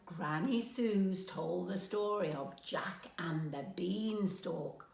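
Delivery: ordinary delivery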